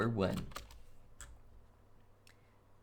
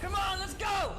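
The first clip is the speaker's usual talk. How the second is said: 1 excited shout. Real, not acted. Gaming shout.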